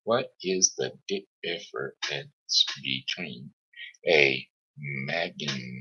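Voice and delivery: slow, drawn-out riddle; slow, careful storyteller riddle; said slowly. Acted. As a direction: spell it out slowly for a small child. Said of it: "I'll say it slowly for you".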